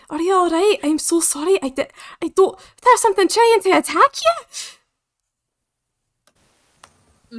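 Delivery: put-on anxious apology with a Scottish accent. Player as Cass making sure a companion is okay, Scottish accent. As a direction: fuss over them in a Scottish accent. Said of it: "What's a Scottish accent".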